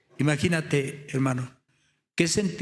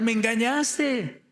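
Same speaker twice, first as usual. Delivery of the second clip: raised-pitch accusing protest; retold outcry; 7 semitones higher than usual. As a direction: cry out in reproach, higher but not truly shouting. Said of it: "le empieza a gritar".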